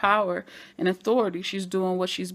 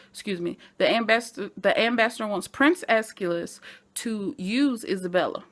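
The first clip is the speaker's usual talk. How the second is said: normal talking voice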